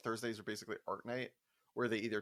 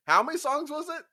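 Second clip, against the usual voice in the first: much higher and louder; eager question; 7 dB louder than usual. Retold or imitated. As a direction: jump in, keen on the deal.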